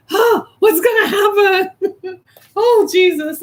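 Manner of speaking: overjoyed and can't hold it in; giddy laughing. Real, not acted. Giddy over the empty nest.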